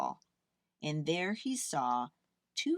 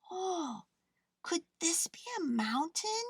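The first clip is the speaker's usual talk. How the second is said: small teddy bear; 10 semitones higher than usual. A narrator's reading. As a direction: gently voice the toy bear.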